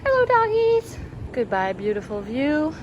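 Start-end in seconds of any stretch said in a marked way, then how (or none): 0.1-1.0 high, loud, drawn-out doggy greeting; pet talk. Real, not acted. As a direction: sing it out to the dogs, slowly. Greeting dogs at cafe.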